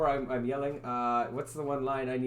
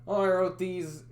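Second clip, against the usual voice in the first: ordinary talk, nothing marked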